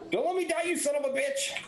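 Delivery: high-pitched desperate mock yell; high, strained cry without full volume; acted yell. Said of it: "I'm gonna yell at Kaz".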